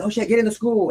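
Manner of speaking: high, urgent mock shout; imitated yell. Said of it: "he's yelling and he's out of breath".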